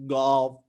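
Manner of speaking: golf as a heavy, rounded "gawlf"; forced standard pronunciation. Shown as a demonstration. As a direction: overdo the O in golf. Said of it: "It's like eating the birds".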